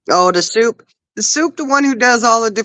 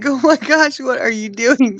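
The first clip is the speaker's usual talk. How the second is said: higher-pitched mock disbelief; retold astonishment; 6 semitones higher than usual. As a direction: replay your amused shock at the sight. Retelling own startled reaction.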